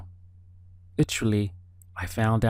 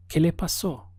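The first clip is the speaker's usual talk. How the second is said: "¿Qué le pasó?" asked as a question, in a higher pitch; questioning intonation; 7 semitones higher than usual. Shown as a demonstration. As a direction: ask "¿Qué le pasó?" with your voice raised in pitch. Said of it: "simply use the intonation of our voice".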